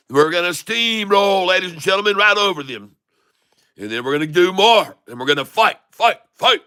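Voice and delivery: rally the viewers like a pep talk; excited hype. Real, not acted. Trader cheering his viewers on as the price climbs, excited.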